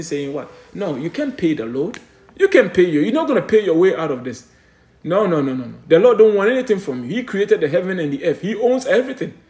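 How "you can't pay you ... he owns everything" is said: raised, louder pulpit voice; fiery preaching. Acted. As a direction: preach it hard to the congregation. Preaching at full force.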